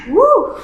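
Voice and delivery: loud sneeze and whoop; big sneeze. Real, not acted. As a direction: sneeze hard then whoop. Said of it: "So I'm gonna sneeze".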